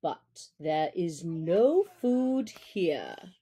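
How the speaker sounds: slow, low wolf voice, guarded; a wary father wolf speaking slowly; gruff father wolf. Acted. Father Wolf to the jackal, slow and guarded.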